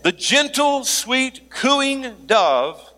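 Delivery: high, slow sugary voice; sugary high dove voice, teasing; mock sweet.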